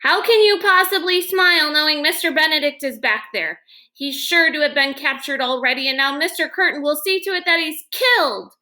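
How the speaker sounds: put-on young girl protesting, distressed; blurted outburst. A narrator's reading. Girl protesting to the man steering the boat, upset outburst. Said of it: "who blurted".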